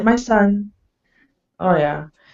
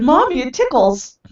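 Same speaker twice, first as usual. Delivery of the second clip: put-on little boy voice; 5 semitones higher than usual. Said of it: "and he said".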